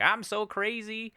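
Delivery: high, slow, goofy declaration; mock zany sidekick. Comedy duo's wild one announcing himself, mock zany. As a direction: stretch it out in a put-on wacky voice. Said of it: "one person who's, like, the normal one".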